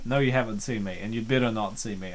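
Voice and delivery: usual speaking voice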